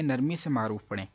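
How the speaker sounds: ordinary talk, nothing marked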